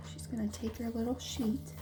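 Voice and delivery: hushed aside; whisper. Acted. Narrator whispers an explanation to the listener. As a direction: share it quietly.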